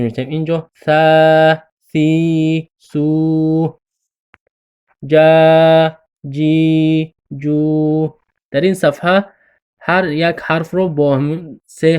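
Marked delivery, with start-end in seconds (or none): 0.3-8.2 ثا ثی ثو جا جی جو each drawn out; long vowels. Shown as a demonstration. Teacher drilling letters with long vowels, drawn out. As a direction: stretch every syllable long. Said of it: "هر یک حرف رو دراز کشیده".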